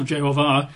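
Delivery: "Jehovah" said as je-ho-VAH; last-syllable stress. Shown as a demonstration. Preacher repeating the demonstration, "Jehovah" stressed on its last syllable. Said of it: "with the emphasis on the last syllable".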